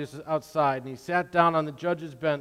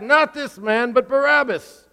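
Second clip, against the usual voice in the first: higher, louder crowd retort; crowd shouting back; 9 semitones higher, 7 dB louder, slower than usual. Acted. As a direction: call it back at him as the mob. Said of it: "They shouted back".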